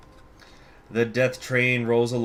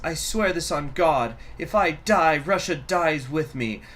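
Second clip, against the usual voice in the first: higher, louder tyrant's oath; vengeful vow; 8 semitones higher, 3 dB louder than usual. Acted. The regent vows to the loyal chieftains, vengeful and intense. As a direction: swear it with venom to the last loyal men. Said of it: "I already voiced it a different way".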